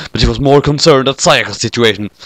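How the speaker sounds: plain reading voice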